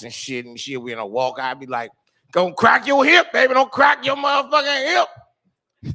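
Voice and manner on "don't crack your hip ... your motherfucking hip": high, slow, loud warning yell; mock yell. Boyfriend yelling after his older girlfriend on a walk, high mock yell. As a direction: holler after her like she's fragile.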